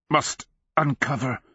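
put-on straining old man's grunt. A narrator's reading. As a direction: push it out through physical effort. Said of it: "he grunted".

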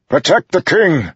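loud, high-pitched command; bellowed. A narrator's reading. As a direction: bellow it as an urgent order. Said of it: "Dalinar bellowed".

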